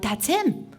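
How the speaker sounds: pointing him out in alarm; excited recognition. Retold or imitated. Spots the man from the car.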